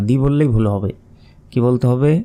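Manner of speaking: ordinary delivery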